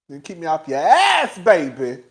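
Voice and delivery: a hint of Mama Joyce; imitating Mama Joyce. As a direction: lightly voice a stern mama. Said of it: "what Mama Joyce told Todd".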